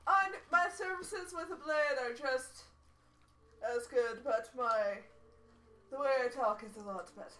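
put-on measured charmer with a Spanish accent; [name]. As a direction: introduce yourself grandly in a put-on Spanish accent. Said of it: "kind of a Spanish accent".

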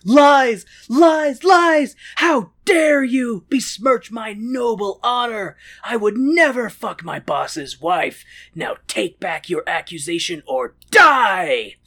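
loud, high, slow theatrical indignation; hammed-up outrage. Lancelot denying the queen's lover accusation, hammed-up outraged shouting. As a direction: bellow it like an offended knight, drawing out each word.

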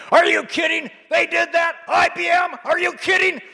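high, far imitation of an excited old man yelling; Bernie on the phone. Bernie bursts in yelling into his phone. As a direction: play him shouting into a phone, disbelieving.

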